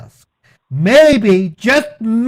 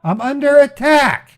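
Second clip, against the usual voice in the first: shouting; 6 semitones higher than usual. Real, not acted. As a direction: yell it in alarm. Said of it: "Why are you shouting, sir?".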